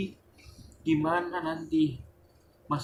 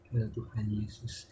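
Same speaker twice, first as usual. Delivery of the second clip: much softer, slow dismayed sigh; hushed sigh; 4 semitones lower, 10 dB quieter than usual. Real, not acted. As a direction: sigh it out under the breath. (desahan pelan penuh kecewa)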